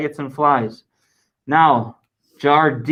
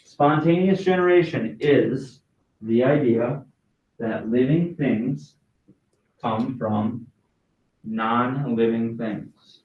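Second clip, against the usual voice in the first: slow dictation, softer each chunk; 3 dB quieter, slower than usual. Shown as a demonstration. Teacher repeating the answer for note-takers, slowly and softer. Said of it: "I'll say it again".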